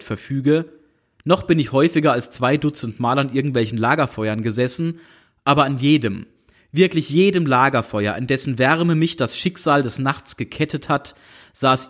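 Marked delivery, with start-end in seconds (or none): none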